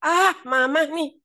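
put-on high, loud, drawn-out child's protest; grandson's yell. Grandson yelling at his mother in the car, loud protest. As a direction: cry out like a kid whose cheek got pinched. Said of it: "tahu-tahu dia teriak".

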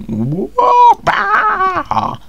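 very high, loud honking goose shout; angry goose impression. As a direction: squawk like an angry goose firing arrows. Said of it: "my best impression of an angry goose".

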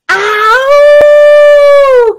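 very high, loud drawn-out "ow"; long cat yowl. A narrator's reading. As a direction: howl like a stung cat. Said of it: "cried the fat cat".

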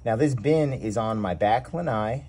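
usual speaking voice

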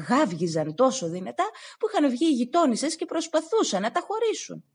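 ordinary delivery, nothing marked

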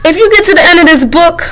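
fake-cry and beg; the book character mock crying and begging. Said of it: "I'm crying now. I'm begging".

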